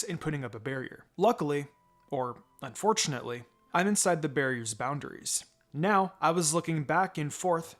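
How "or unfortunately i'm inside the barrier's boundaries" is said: plain reading voice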